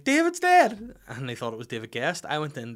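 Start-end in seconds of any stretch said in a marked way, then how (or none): 0.0-1.0 very high, loud wail of grief; hammed-up grieving cry. Imitating a TV widow, loud high wail. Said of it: "like [name]'s wife in Big Brother".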